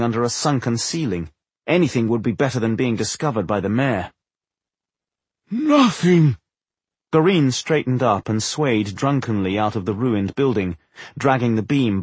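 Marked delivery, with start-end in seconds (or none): 5.5-6.4 loud, high desperate cry; anguished cry. Acted. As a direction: howl it out in torment. Undead wizard cries out.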